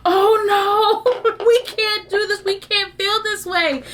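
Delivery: high, half-laughing "oh no" to herself; laughing mock alarm; mock panic. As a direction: raise the pitch in playful alarm. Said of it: "And I was like".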